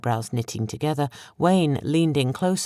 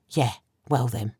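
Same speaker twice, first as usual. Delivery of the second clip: hissed; 3 semitones lower, 4 dB quieter than usual. A narrator's reading. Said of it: "Betsy hissed".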